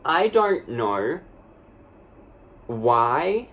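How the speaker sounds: ordinary delivery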